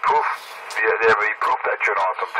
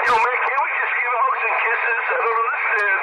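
falsetto; 13 semitones higher than usual. Pretend. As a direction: gush it in a high falsetto. Gushing falsetto on radio.

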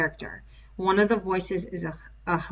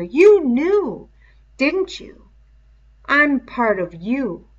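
much higher, much louder triumphant gloat; gloating beast; 7 semitones higher, 9 dB louder, slower than usual. Acted. The beast gloating to Simon that it is part of him, loud and high. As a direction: crow over him, the monster unmasked.

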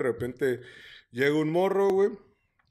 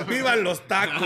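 mock patriotic cheer; 5 semitones higher, 5 dB louder than usual.

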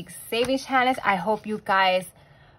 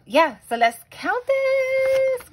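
forced cheer; 5 dB louder, much slower than usual. Real, not acted. Forcing cheer to change subject.

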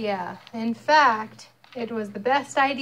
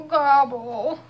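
mild grumble; 3 semitones higher, 5 dB louder than usual. Acted. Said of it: "grumbled Turkey".

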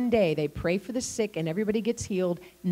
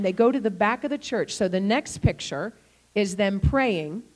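usual speaking voice

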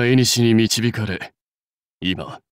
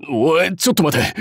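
higher-pitched startled objection; taken aback; 5 semitones higher than usual. Acted. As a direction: cut in with a sudden "hold on". Character suddenly objects, alarmed.

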